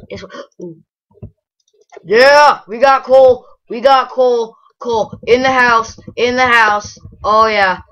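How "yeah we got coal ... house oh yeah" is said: loud, high cheer over finding coal; excited cheering. Real, not acted. Player cheering at finding coal, loud and excited. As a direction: celebrate like a hype man.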